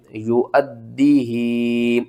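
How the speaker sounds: slow, drawn-out recitation; slow recited model. Shown as a demonstration. Teacher models the joined reading for students, slowly. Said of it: "teacher models the joined reading".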